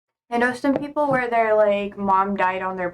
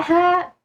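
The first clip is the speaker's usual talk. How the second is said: mock cry; 8 semitones higher, 5 dB louder than usual. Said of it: "I'll just be like".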